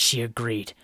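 ordinary delivery

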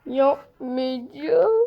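very slow, dragged whine; sulky refusal. Real, not acted. Kid giving up on the level, slow sulky whine. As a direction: drag it out sulking.

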